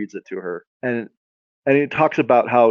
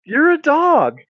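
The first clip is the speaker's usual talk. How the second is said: high-pitched comic wail; incredulous; 4 dB louder, slower than usual. Acted. Woman objects that her guide is a dog, incredulous. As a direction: protest it high, in disbelief.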